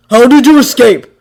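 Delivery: loud sharp demand; angry demand. A narrator's reading. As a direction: bark the question.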